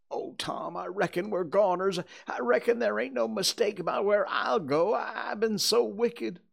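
high, loud, panicky lament; despairing boy. Acted. Huck tells Tom they are goners, wailing. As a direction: wail it like a doomed, guilty boy.

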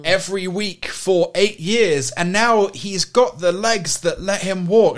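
mock uplifting news voice. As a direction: read it like a saccharine feel-good story.

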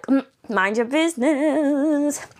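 slow sing-song brush-off; sassy brush-off. Acted. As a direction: stretch it out, telling a nosy person off. Retort to nosy late texters.

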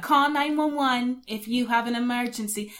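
slow New York phone-menu recording; attempted New York accent. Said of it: "can't even do a New York accent".

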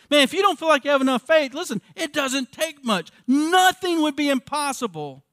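loud, high preaching at full force; fiery preaching. Acted. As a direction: preach it out to the whole church.

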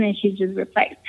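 ordinary delivery

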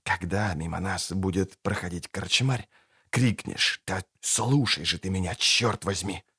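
low rat-like hiss; hissed whisper. A narrator's reading. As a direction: whisper with menace. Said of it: "прошипел Кайлей".

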